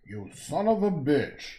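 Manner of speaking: a loud, drawn-out attempt at Arnold's bark; slow, punchy movie-star growl, badly done; Arnold Schwarzenegger impression. Vlogger failing at Arnold while painting, slow loud mock bark. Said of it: "trying to do an Arnold Schwarzenegger impression".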